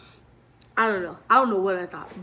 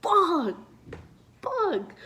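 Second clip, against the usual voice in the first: panicked curse; 9 semitones higher than usual. Retold or imitated. Cops burst in, panic.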